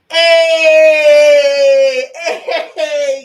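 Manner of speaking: high, loud whooping cry of joy; ecstatic shout. Real, not acted. Reader celebrating with her viewers, ecstatic shouting. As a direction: whoop it out in sheer delight. Celebrating going through the portal.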